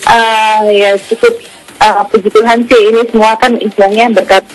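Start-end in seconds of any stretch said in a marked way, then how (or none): none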